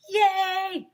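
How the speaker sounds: high, loud yay; gleeful shout; kids shouting yay. Acted. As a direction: cheer out. Boys shouting.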